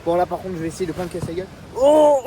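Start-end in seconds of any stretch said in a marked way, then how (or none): 1.8-2.2 startled. Real, not acted. Nearly slips on path.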